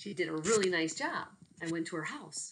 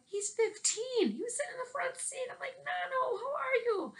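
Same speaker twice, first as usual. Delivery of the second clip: excited. Retold or imitated. Surprised greeting former student.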